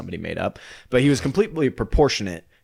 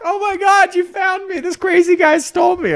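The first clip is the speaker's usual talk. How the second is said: put-on very high, loud kid voice; rescued kid; 7 dB louder than usual. Kidnapped kid greeting his rescuer, very high excited voice. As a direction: squeal it as an excited found child.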